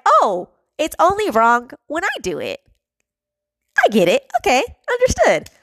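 mock-understanding, pitch leaping up at the end; sarcastic acceptance. Venting about hypocrites who do the same things, sarcastic acceptance. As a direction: feign getting it, rising into high sarcasm. Mocking double standard of hypocrites.

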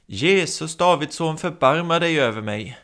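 high, loud pleading shout; desperate cry. Acted. Blind man shouting to Jesus despite threats, desperate cry. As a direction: refuse to be silenced. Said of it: "låter sig inte hejdas".

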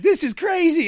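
high-pitched mimic of an exasperated son; mock exasperated squeal; exasperated kid. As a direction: whine it way up high like an overwhelmed kid. Mimics son in escape room.

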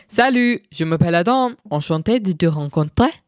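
showing off learned French with an English accent. Shown as a demonstration. Vlogger flexing self-taught French for listeners, American accent. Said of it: "Can I flex on you".

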